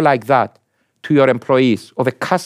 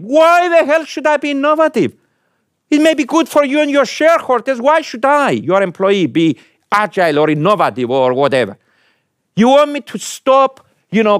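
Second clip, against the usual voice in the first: defiant employee; 11 semitones higher than usual. Retold or imitated. Employee pushing back on change.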